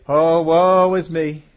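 loud slow wailing lament; hammed-up cry of self-pity, dragged out; mock woe is me. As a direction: Moses pretending to despair before the angry people, loud and slow. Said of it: "he could say".

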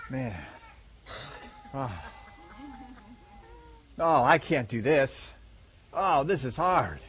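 slow, tired mock groan of aching muscles; hammed-up post-workout groan; sore groaning. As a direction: drag it out like every muscle aches. Sore after first gym day.

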